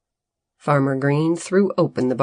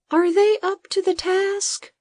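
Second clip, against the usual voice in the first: high, lightly nervous question; nervous Bodo. A narrator's reading. Assistant doubting the robots, nervous high voice. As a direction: ask in a worried high voice. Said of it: "Bodo asked nervously".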